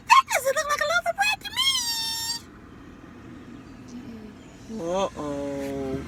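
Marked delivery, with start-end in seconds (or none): 0.1-4.8 very high, fast squeaky armadillo; shrill critter shout, fast. Acted. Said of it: "And the armadillo said".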